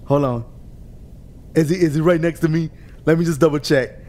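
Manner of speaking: low, sneaky mock inner voice of the rival; imitating Donkey's thoughts. Streamer voicing Donkey sneaking up, low mock musing. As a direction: play the cautious racer checking his mirror, pitched low. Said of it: "He's like".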